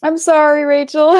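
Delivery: apologizing through laughter; giggly, loud and high; laughing. Real, not acted.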